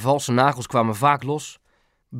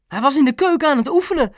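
high, fast, louder gabble; enthusiastic chatter; 12 semitones higher, 5 dB louder, faster than usual. A narrator's reading. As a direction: gabble it out eagerly. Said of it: "snaterde Mam enthousiast". (enthousiast snaterend)

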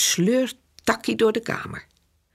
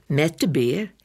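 usual speaking voice

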